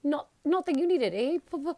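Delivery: high, loud, jittery stammer; nervous stammer. A narrator's reading. Quirrell nervously jokes to Harry, high and loud, stammering. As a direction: force a shaky jest.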